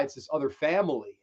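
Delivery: normal talking voice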